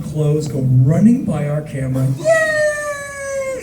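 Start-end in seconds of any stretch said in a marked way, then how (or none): 2.2-3.6 high drawn-out crowd cheer; mock cheering. Festival crowd running past the camera, mock high cheer. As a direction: squeal like a fan rushing in. Crowd runs through the opened doors.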